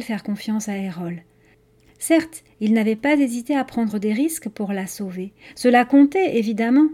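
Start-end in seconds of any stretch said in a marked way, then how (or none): none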